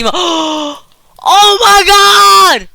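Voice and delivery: fast talk breaking into a scream; startled panic. Real, not acted. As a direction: gasp and shriek in fright. Monster surprises him mid-sentence.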